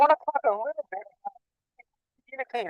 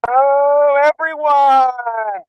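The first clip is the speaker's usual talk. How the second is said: loud, drawn-out, slow welcome; big hello; 4 semitones higher, 7 dB louder, much slower than usual. Real, not acted. Scuba diver opening the live show to viewers, loud slow excited hello. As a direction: greet the whole crowd with a big stretched hello.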